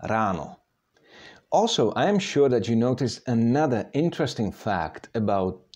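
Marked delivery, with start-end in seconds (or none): 0.0-0.6 "rano" with a fully trilled R; rolled Czech R. Shown as a demonstration. Teacher shows how it sounds, "rano" with rolled R. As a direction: roll the R at the start of "rano". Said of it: "So it sounds rano".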